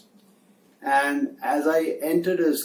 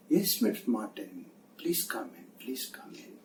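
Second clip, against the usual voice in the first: softened boss voice; 2 semitones lower, 10 dB quieter, faster than usual. Retold or imitated. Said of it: "sudden change in the tone of his voice".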